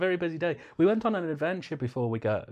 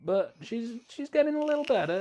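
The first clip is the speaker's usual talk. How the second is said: mock delicate. Mock delicate about partner.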